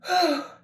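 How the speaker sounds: high sighing breath as the tears start; a real sigh as she fights back tears; tearful sigh. Real, not acted. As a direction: let a tearful sigh escape. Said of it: "I didn't think I was gonna cry this early".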